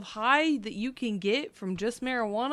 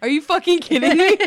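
yelling; 8 semitones higher, 10 dB louder than usual. Retold or imitated. Retelling cussing at customer.

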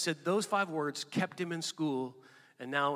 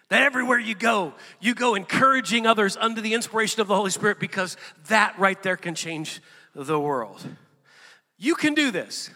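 louder, driving preacher's call to the congregation; full-force preaching; 4 semitones higher, 11 dB louder than usual. Acted. Pastor preaching to the congregation, full-force sermon voice. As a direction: preach it out to the room with conviction.

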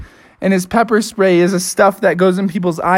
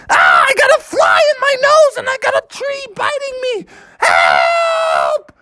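very high, frantic yelling; hammed-up shriek for help; panicked scream. Bubby bitten by a tree calls for help, panicking.

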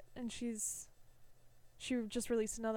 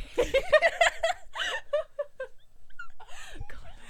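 long, high, squealing laughter; helpless laughing fit; 8 dB louder than usual. Real, not acted. Cohosts lose it over their muddle, helpless shrieking laughter. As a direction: laugh loudly until it sounds like crying.

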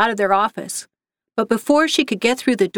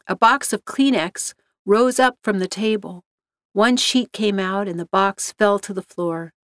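plain reading voice

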